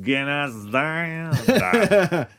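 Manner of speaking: mock German accent, sung slow and drawn out; terrible German accent. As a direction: ham up a bad German accent over the carol. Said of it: "for my terrible horrible German accent".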